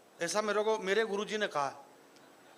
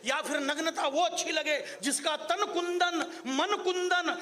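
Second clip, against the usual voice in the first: poetic declamation; 5 semitones higher, slower than usual. Acted. Poet declaims poetic lines.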